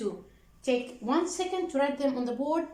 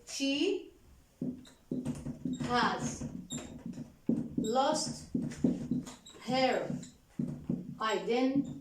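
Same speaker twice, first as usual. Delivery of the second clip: each word alone, long pauses, softer; slow dictation; 3 semitones lower, 7 dB quieter, slower than usual. Shown as a demonstration. Teacher dictating to students, slow and softer, word by word. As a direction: give them time to write every word. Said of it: "teacher dictates word by word".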